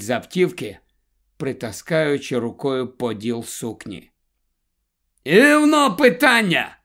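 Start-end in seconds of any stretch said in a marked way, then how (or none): 5.2-6.8 higher, louder cheerful call. A narrator's reading. Helen calls out to the woman, loud. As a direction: shout it across to the driver. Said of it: "гукає Гелен".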